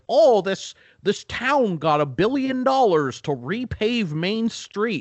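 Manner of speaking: hammed-up local reporter; mock news story. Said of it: "writing stories like".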